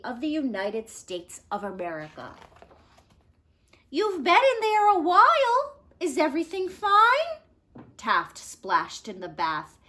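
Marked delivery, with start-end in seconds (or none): 3.9-7.4 wife calling in. Acted. Wife calling through door.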